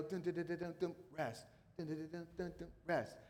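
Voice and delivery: soft vocal drumbeats "dun, dun"; drum cadence. Shown as a demonstration. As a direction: tap out a drum rhythm with the voice, quietly. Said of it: "like the drum cadence".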